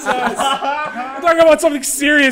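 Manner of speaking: loud, high mock outrage through laughter; laughing protest. Real, not acted. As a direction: complain while cracking up.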